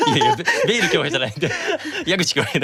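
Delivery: quick laughing no-no; laughing protest. Real, not acted. Guest laughing off being called by his role name. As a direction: laugh it off, fast and high.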